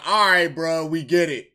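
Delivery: loud mock exasperation; imitated fed-up reaction. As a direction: throw it out loudly as an imagined fan's reaction. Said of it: "is just like".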